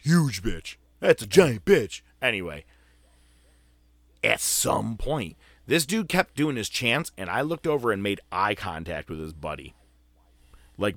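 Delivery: usual speaking voice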